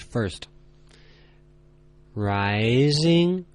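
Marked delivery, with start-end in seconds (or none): 2.1-3.4 "rising," with the pitch sliding upward. Shown as a demonstration. Teacher showing learners the second tone, voice climbing. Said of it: "Read only the tones and inflections".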